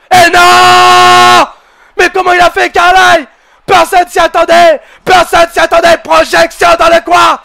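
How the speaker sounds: hyped French wrestling commentator calling every move; live play-by-play. Acted. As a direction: call the match like a ringside announcer.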